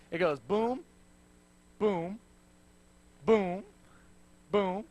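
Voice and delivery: plodding footfall sounds; thudding footstep booms. Shown as a demonstration. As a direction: boom boom spaced like heavy steps. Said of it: "my feet do this".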